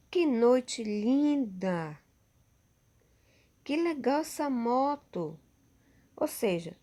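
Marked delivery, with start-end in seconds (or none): 0.1-2.0 slow, drawn-out delight at a lovely night; teacher demonstrating admiration, slow and lifted; admiring exclamation. Shown as a demonstration. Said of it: "tá admirando". 3.6-5.4 higher, bright surprise at a cool motorbike; teacher showing delight at a motorbike; admiring exclamation. Shown as a demonstration. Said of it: "tá admirando".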